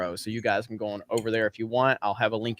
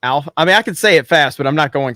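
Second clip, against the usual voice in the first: talking louder, distracted; 8 dB louder than usual. Real, not acted. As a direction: raise the voice, half distracted. Loud distracted reply.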